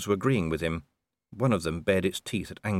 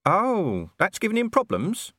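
high anxious little dog voice; a worried mutt, pitched high; small dog voice; 12 semitones higher, 3 dB louder than usual. Acted.

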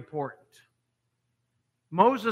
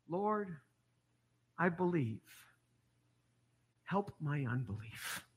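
much softer, pleading father; softer and lower, a humble desperate prayer; hushed plea; 3 semitones lower, 12 dB quieter than usual. Acted. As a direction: breathe it out like a broken father begging.

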